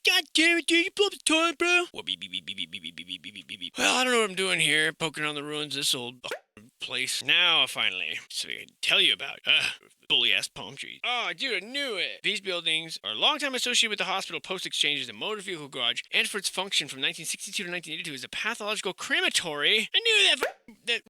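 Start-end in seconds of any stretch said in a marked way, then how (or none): 0.1-1.8 high, loud hammed-up fury; over-the-top mock rage, high and loud; mock outrage. 1.9-3.8 imitated beeping. 19.9-21.0 excited exclamation. Real, not acted.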